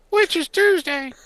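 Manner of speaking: squeaky, very high, slow cartoon voice; silly high voice. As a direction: jump way up into a goofy squeak. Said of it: "Let me do voices".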